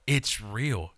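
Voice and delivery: ordinary talk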